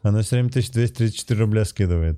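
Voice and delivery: fast. Real, not acted. Fast donation joke.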